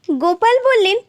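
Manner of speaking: usual speaking voice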